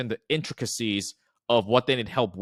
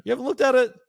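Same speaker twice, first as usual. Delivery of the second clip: teasing, mock-shocked and much higher; playful; 4 semitones higher, faster than usual. Shown as a demonstration. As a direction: playfully scold the lead to get them talking. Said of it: "So something playful to kinda get them open up".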